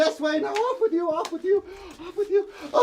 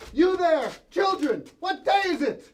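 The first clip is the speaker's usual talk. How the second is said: ordinary delivery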